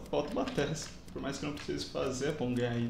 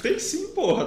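grito irritado; 13 semitones higher, 7 dB louder, slower than usual. Real, not acted. Discussão acalorada no jogo.

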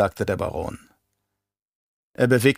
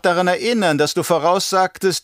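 high, probing baron voice; bohrender Baron; 7 semitones higher than usual. Acted. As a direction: press the Mentat for an admission. Baron questions his Mentat. (hohe Baronstimme, lauernd fragend)

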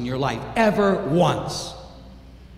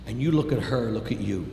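ordinary talk

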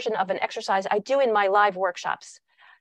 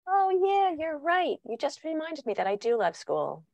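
high, sing-out "oh yeah", dropping softer; mock agreement; 6 semitones higher, 3 dB quieter than usual. Child supposedly persuaded by parent about school, mock agreement. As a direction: imitate a child suddenly and implausibly convinced. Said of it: "Has any child ever responded".

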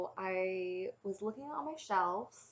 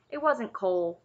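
louder, hasty objection; interrupting; 2 semitones higher, 9 dB louder than usual. A narrator's reading. Taryn cutting in to defend Cole, interrupting. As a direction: break in firmly. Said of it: "Taryn interrupted".

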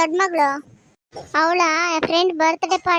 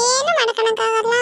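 put-on cheeky little girl; 4 semitones higher than usual. Child teases grandma.

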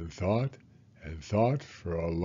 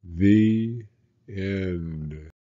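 story ending; 7 dB louder than usual. A narrator's reading. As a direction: close the book with a flourish. Closing the story.

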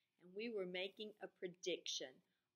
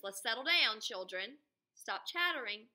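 louder, firm classroom command; stern teacher call; 3 semitones higher, 8 dB louder than usual. Acted. Miss Kelly settling the chattering class, louder and firm. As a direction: call the noisy class to order. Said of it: "Miss Kelly snapped her fingers".

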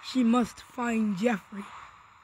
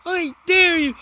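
higher, louder challenge; loud dare; 5 semitones higher, 6 dB louder than usual. Real, not acted. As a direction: throw the dare out forcefully at the enemy. Taunting a mob in game.

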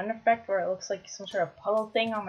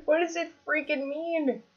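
double rainbow impression; 7 semitones higher than usual. Player carrying on the double rainbow quote, high mock awe. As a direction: plead it high in mock bewilderment. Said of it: "It's like a double rainbow".

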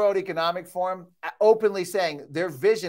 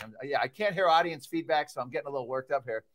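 ordinary talk

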